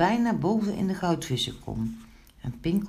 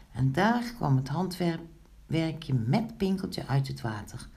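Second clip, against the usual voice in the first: ordinary delivery, nothing marked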